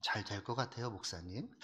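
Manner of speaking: soft, hesitant plea to the pastor, fading out; timid believer asking. Retold or imitated. Church member softly asking the pastor if things will go well. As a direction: ask the pastor meekly for reassurance. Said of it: "환경과 사람에게 자꾸 길을 물어요".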